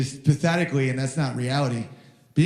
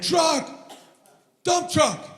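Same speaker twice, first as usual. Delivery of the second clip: put-on much higher shouting kid's thrill; excited toddler shout; 12 semitones higher, much slower than usual. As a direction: yell it like a thrilled little boy. Son excited by trucks.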